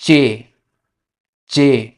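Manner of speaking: चे said as Spanish "che", clear and slow; teacher modelling चे for learners; Spanish letter name. Shown as a demonstration. Teacher to students, slow clear Spanish letter name. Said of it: "इसको बोलते हैं".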